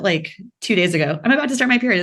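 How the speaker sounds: ordinary talk, nothing marked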